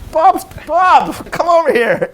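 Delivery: high, loud call across the room; calling out excitedly. Retold or imitated. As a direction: shout for Bob to come over fast. Calling Bob over.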